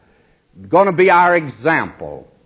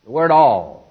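usual speaking voice

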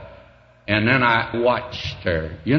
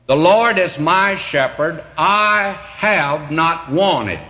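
raised, ringing pulpit proclamation, slowing at the end; preaching at full force; 6 semitones higher, 3 dB louder, slower than usual. Acted. Preacher proclaiming his faith to the congregation, raised and slow. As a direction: declare it high and loud like a testimony.

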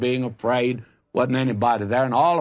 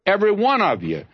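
full-force preaching; 6 semitones higher than usual. Acted. Preacher urges his congregation to trust God, full force. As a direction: pour it out like a revival preacher.

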